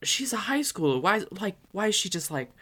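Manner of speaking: a hint of scandalized girlfriend; girlfriend's indignant voice. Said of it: "she was like".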